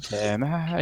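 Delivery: soft kobold murmur; whispering. Acted. Game master as Tim creeping into the cave, whispering. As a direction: say it softly while creeping along.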